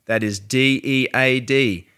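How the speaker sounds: upbeat sponsor read, loud. Acted. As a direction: read the sponsor spot with punch.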